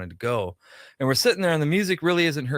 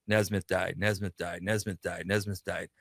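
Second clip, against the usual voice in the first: low, soft repeated refrain; retold message flood; 5 semitones lower, 6 dB quieter than usual. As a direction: read out identical incoming messages, low and unvarying.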